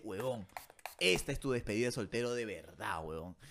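dropped low, soft buddy voice; buddy's low voice. Retold or imitated. Friend urging his buddy to party, low mock voice. Friend's line, much lower voice.

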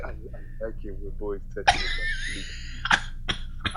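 quiet, slow, whispery creep; leering creep imitation; creep-ass voice. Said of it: "just say".

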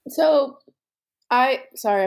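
talking through a mouthful of toast; words pushed round a bite of food; mouth full. Real, not acted. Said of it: "I'm eating peanut butter toast".

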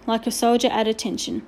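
usual speaking voice

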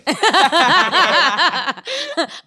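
real burst of high laughter; high loud laugh. Real, not acted. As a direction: crack up loudly. Cracking up at the bit.

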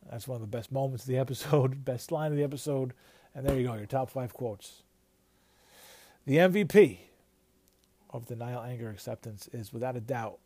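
ordinary talk, nothing marked

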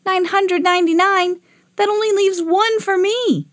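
put-on high-pitched boyish protest; dismayed disbelief. A narrator's reading. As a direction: repeat it back aghast. Boy dismayed at the number.

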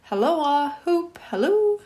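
high, whooping halloo; calling out. A narrator's reading. Scrooge hails Friday running to the creek, calling out. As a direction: holler a gleeful hail, pitch leaping up.

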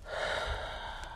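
very quiet breathy exhale; long soft sigh. Real, not acted. After telling of his passing, long soft sigh. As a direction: let the breath go slowly.

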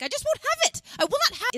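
high, loud mock mother refusing; stubborn mother. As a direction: play a mom digging in her heels. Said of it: "mother who's like".

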